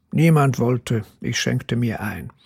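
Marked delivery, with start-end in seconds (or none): none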